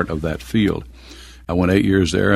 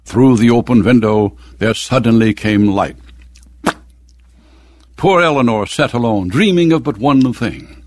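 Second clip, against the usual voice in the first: a mock-melodramatic recital, louder and rising higher; dramatic reading; 4 semitones higher, 4 dB louder than usual. Shown as a demonstration. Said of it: "Like the very first line is".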